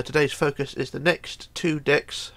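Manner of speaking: ordinary delivery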